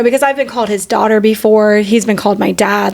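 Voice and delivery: ordinary talk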